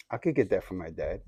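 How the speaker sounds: soft, low voicing of a private thought; inner thought. Small boy planning to himself to get dad's cologne, quiet inner thought. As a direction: think it aloud quietly, low in pitch. Child's secret thought in story.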